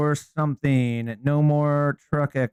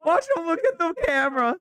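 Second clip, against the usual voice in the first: high mocking voice; 13 semitones higher, 3 dB quieter than usual. Real, not acted. Mocking reaction to news clip.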